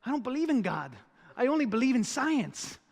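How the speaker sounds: comically earnest high protest in a put-on accent; Esqueleto. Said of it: "Esqueleto looks at him with big eyes".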